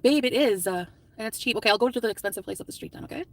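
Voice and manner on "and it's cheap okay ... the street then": ordinary delivery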